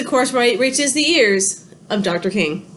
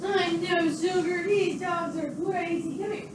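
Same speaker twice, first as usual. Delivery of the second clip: much softer, higher, slow hushed coaxing; soft comforting; 6 semitones higher, 11 dB quieter than usual. Real, not acted. Owner soothing her frightened cat, soft and hushed. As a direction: coax the frightened cat close.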